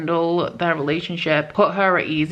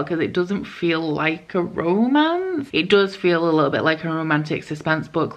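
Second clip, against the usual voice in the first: ordinary talk, nothing marked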